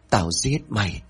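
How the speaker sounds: a demonic voice that sets the word "giết" apart and lets it fall; possessed scream. A narrator's reading. Possessed son strangling his father, demonic "giết". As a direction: spit out "giết" like a possessed thing. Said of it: "mà hét lên".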